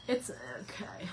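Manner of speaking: soft unvoiced whisper. Real, not acted. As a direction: breathe it out. Whispered aside.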